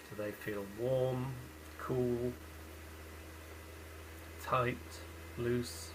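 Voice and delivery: unhurried body-scan guidance with long pauses; calm meditation guide, leaving space after each phrase; guided meditation. Real, not acted. Leading listeners through a lying-down body scan, guided meditation.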